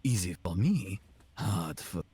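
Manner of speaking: menacing German-accent interrogator. Shown as a demonstration. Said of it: "German, it would be like".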